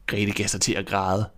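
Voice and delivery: usual speaking voice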